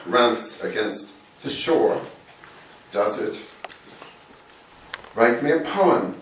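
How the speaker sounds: measured poem reading, long pauses between phrases; poetry reading. Acted. Poet reads a short poem to an audience, poetry reading. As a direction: read it line by line, letting key words lift.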